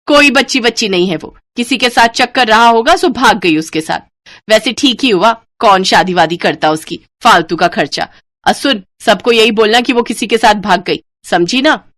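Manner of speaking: loud, bossy scornful lecture; callous order. Acted. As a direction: lay down the story to tell. Babita commands callously.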